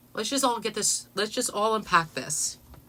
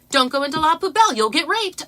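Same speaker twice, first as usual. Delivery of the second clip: real casual; 7 semitones higher, 8 dB louder than usual. Retold or imitated. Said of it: "real casually".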